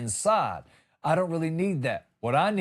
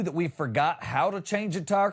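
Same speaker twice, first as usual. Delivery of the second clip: ordinary delivery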